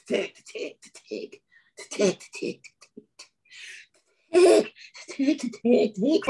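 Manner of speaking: stuttering imitation. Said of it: "He goes".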